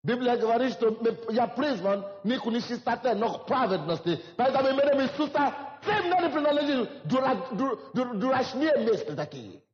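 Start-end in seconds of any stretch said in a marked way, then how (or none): none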